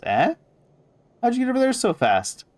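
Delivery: high, loud squawk of surprise; startled question. Acted. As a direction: jump in pitch, astonished crone. Marta startled by the speaker's position.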